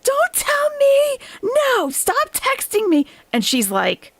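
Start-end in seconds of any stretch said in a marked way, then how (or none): 0.0-3.1 very high, drawn-out whiny yell; mock yelling. Mimicking Chantal yelling at Salah, high mock yell. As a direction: imitate a hysterical rant, slow and high. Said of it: "she's yelling at Salah".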